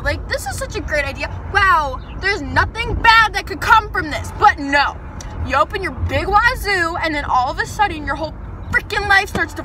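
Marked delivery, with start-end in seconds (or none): none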